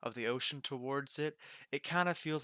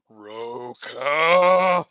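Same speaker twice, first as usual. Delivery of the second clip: huge, booming shout of a name; angry shout; 15 dB louder than usual. Acted. The boss bellows at Roka for eating stock. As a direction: bellow across the shop.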